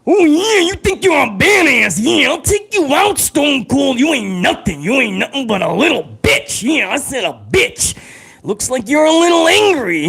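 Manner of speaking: high, gravelly-free hammed-up Macho Man trash talk; Macho Man promo. Streamer mocks Stone Cold as Macho Man, hammed-up high promo voice. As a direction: sneer it out like a hyped wrestling promo. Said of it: "could you imagine Stone Cold and the Macho Man in a promo battle".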